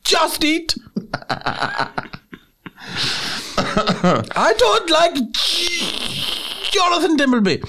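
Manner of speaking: put-on high German accent, straining on a held-back word; stifled mock German. Joker as a German who can't admit it, strained German accent. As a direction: fight the word down, then blurt a substitute. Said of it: "They have to stifle it".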